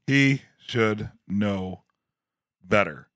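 HE SHOULD KNOW BETTER, word by word with gaps, dropping low; slow, deliberate emphasis. Real, not acted. Co-host driving home his verdict on Howard, slow and deliberate. Repeats the line deliberately slower.

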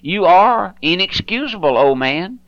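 normal talking voice

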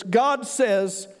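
normal talking voice

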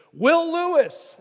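loud, slow call across a noisy room; calling out a name. Retold or imitated. Professor calling a student over the classroom chatter, loud and slow.

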